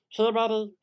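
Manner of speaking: high, loud call to Betty; impression of a pleading husband. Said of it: "Can you do an impression of Wet Movie One?".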